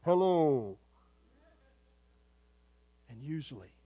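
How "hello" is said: a mock booming call from heaven, a bit louder. Preacher mocking the loud heavenly voice people expect. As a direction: boom out a hammed-up voice of God. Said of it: "with this loud voice".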